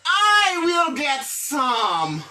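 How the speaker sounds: slow modelled reply, word by word; lecture lente. Shown as a demonstration. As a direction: give the class the model answer slowly. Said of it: "le faire action au il dit". (réponse modèle dite lentement)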